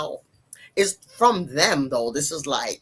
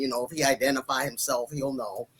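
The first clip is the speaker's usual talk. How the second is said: ordinary delivery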